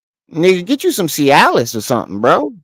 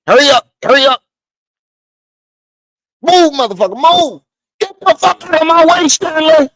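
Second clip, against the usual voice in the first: mock yell; 11 semitones higher than usual. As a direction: shove everyone aside, yelling high. Bishop voiced shoving past photographer.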